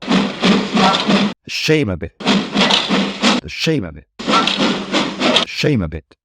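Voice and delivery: drawn-out, slow grumble fading to a whisper; cross engine muttering under his breath; slow chugging grumble. Acted. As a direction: chug the words out like a tired engine. Gordon grumbling up slowly.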